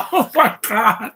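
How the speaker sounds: real laugh, high, slowly saying "Oh my God". Real, not acted. Viewer laughs hard as the cop car is destroyed. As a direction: crack up and get the words out through the laugh.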